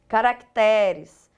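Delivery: caracteres slowly stressed on TE; paroxytone stress. Shown as a demonstration. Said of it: "nas palavras paroxítonas".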